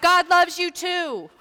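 high, slow called reply; yelled back. Retold or imitated. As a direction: shout it back from the sidewalk. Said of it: "some yelled back".

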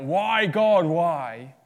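loud, high cry of why to God; anguished cry. Retold or imitated. A sufferer crying out to God, loud and high. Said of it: "when you cry out".

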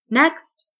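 loud, high call of next; clerk calling out. A narrator's reading. Clerk calling the next customer to the register, loud. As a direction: summon the next customer across the shop. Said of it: "the clerk called".

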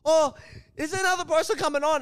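high-pitched mock Irish woman; high imitation of an Irish woman. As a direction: put on a high woman's voice, querying. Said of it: "the Irish chick is like".

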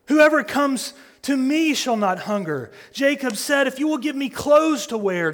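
ringing sermon climax; full-force preaching. Real, not acted. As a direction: preach it out with fire. Sustained loud preaching.

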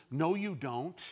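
normal talking voice

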